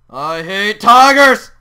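slow, drawn-out loud complaint; exasperated gamer outburst; frustrated shout. Real, not acted. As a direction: build to a yell on "tigers". Frustrated after tiger escapes.

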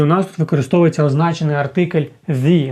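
usual speaking voice

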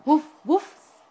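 loud, high storybook woofs; dog barks. A narrator's reading. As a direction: bark it out like a picture-book dog. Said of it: "said the dog".

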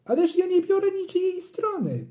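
very high, protesting dad; astonished father. A narrator's reading. Father protesting to mother, astonished. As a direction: protest in disbelief. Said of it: "zdziwił się tata".

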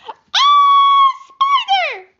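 piercing high shriek of fright; terrified scream. Acted. Family member shrieks on finding the spider.

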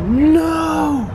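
high dismayed shout; dismayed cry. Real, not acted. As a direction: cry out in disbelief. No more bagels.

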